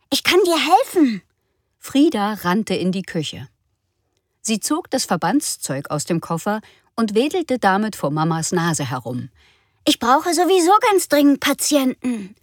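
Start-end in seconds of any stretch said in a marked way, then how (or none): 0.1-1.2 put-on high, loud child voice; eager little girl. Frieda offering to help her burned mother, high child voice. 9.9-12.4 put-on high, loud child voice; eager little girl. Frieda waving bandages at Mama, high eager child voice.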